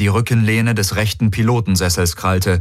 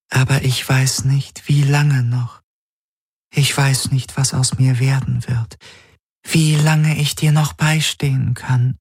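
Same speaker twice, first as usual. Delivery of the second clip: fading dream figure; 6 semitones higher than usual. A narrator's reading. The dream figure tells Garland she is fading, gently sad. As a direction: sound fragile and sad.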